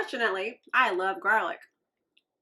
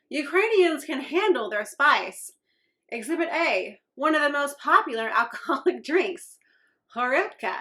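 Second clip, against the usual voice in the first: upbeat, bright ad-copy read; box copy read-out; 6 semitones higher, 6 dB louder than usual. Acted. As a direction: read the package like a peppy ad. Reads the snack box text.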